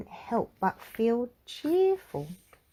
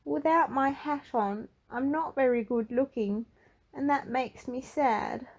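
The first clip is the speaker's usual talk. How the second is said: higher, lightly voiced, a touch of sadness; Mister Cheerful confessing; 6 semitones higher than usual. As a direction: confide it shyly, a hint sad. Mister Cheerful confesses his secret.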